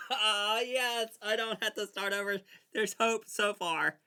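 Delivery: high, loud, overjoyed outburst; elated relief. Real, not acted. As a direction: cry out with giddy relief. Relief at saving the game.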